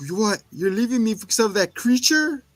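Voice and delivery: hammed-up shock at being left; mock disbelief. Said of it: "He's like".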